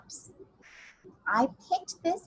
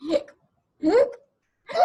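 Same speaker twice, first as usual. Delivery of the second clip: hiccups; 13 semitones higher, 9 dB louder than usual. Pretend. Imitating ghost's hiccups.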